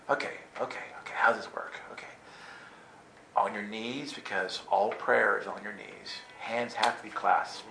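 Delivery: hushed whisper to himself. Retold or imitated. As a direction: whisper it under your breath. Whispered inner thoughts.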